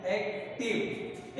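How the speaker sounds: एक्टिव slowly, broken into एक and टिव; split into syllables. Shown as a demonstration. Teacher giving another example, एक्टिव said slowly in two parts. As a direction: leave a clear gap before टिव in एक्टिव. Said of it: "इस तरह से double syllable".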